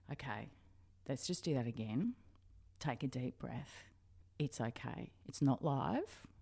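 soft, slow reassuring voice; calm a nervous newbie gently; soothing producer. Retold or imitated. Producer calming the nervous new reporter, soft and reassuring.

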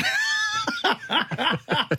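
helpless high-pitched giggling; high laughter. Real, not acted. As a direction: crack up at your own prank. Cracks up at own prank.

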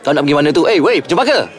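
high, loud urgent call; calling after her. Acted. Aaron calls after Cempaka as she walks off, loud and urgent. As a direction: shout for her to stop.

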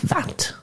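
"that" quoted as "vat"; accented pronunciation. Shown as a demonstration. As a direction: show the accented "that", starting with a v. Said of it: "He pronounces it that".

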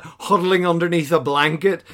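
ordinary delivery

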